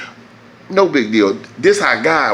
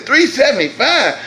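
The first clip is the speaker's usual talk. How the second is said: high disbelieving repeat of the price; incredulous; 12 semitones higher than usual. Retold or imitated. Repeating the mechanic's price in outraged disbelief. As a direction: echo the price in shock, way up high.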